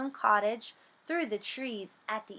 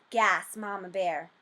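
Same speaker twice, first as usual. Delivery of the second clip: plain reading voice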